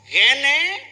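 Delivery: loud yell sweeping up high; escalated to top key. Retold or imitated. Father losing patience with his child in the car, high yell. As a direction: mimic an exasperated parent's rising yell. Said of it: "sampai ke A".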